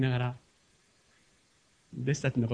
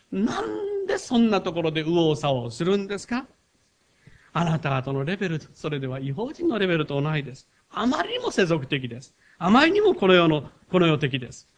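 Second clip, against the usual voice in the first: raised, emphatic sermon scolding; rebuking preaching; 4 semitones higher, 5 dB louder than usual. Acted. Jesus scolding disciples for worldliness, emphatic preaching. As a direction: press the point home to the disciples.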